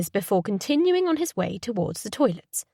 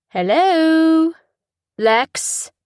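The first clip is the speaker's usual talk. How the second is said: caller's voice; 8 semitones higher, 8 dB louder, much slower than usual. Acted. Unknown caller on the phone.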